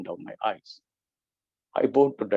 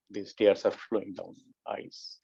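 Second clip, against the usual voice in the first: slow, soft, tearful; 3 semitones lower, 6 dB quieter, slower than usual. Real, not acted. Speaker moved to tears retelling his prayer, softly weeping. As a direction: let the tears come through slowly. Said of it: "I am weeping, crying".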